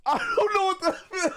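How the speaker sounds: high, wailing mock despair; desperate cry. Real, not acted. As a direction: cry it out like you're at your wits' end.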